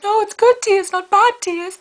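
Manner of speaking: high, put-on voice; joking posh reply. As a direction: answer in a silly put-on high voice. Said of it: "And I was like".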